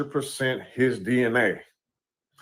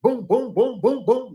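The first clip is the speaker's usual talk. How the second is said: high, punchy boom boom mouth gunfire; imitated gunshots; 10 semitones higher than usual. Recounting the shooting in the car, mimicking gunshots. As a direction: spit out each boom like a shot going off.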